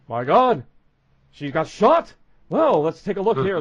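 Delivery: loud, theatrical doctor arriving on the scene. Retold or imitated. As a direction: bellow it like an over-the-top TV doctor. Doctor character voiced in role-play.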